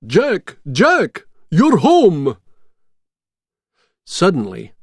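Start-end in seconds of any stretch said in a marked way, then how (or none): 0.1-2.4 high excited shouts of welcome; shouting. A narrator's reading. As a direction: cheer his name out loud. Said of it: "the skeletons shouted".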